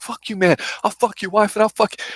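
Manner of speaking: high, squeaky imitation of a mouthy pimp; hammed-up high pimp voice; mock pimp trash talk. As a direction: squeal it like a cocky street hustler. Quoting the trash-talking pimp.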